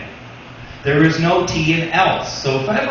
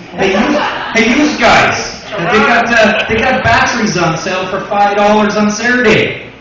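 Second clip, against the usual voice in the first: put-on louder, eager Hamilton accent; Hamilton dad voice; 4 semitones higher, 6 dB louder than usual. Comic imitating his Hamilton dad announcing a battery sale, loud Hamilton accent. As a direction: play the dad bragging about a sale. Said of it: "I says to him".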